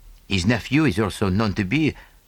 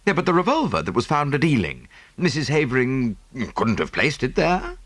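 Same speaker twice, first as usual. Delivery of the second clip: lightly voiced young Englishman, higher; Hastings voice; 8 semitones higher, faster than usual. Hastings objecting to Poirot, lightly voiced. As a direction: Hastings brightly cuts in.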